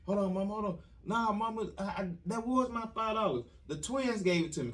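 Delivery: put-on kid's excuse. Kid lying to mom about money.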